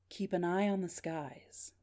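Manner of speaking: slow, soft mysterious caller; faint, measured phone voice; weak, unclear voice. Acted. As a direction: let each word out slowly and faintly. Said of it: "This part is weak and unclear".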